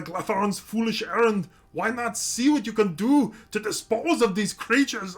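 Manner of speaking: high, loud barbarian quest giver; Grognak voice. Acted. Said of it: "It's that Grognak the Barbarian".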